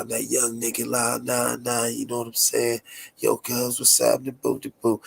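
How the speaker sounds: a lightly voiced young rapper; hint of teen rapper swagger. Shown as a demonstration. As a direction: slip lightly into your first rapper voice. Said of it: "my first rapper voice".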